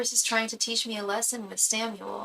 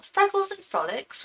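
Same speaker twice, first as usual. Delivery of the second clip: a quick mock British accent, higher; 11 semitones higher than usual. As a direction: read the username like a Brit, playfully. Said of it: "Even your username sounds British".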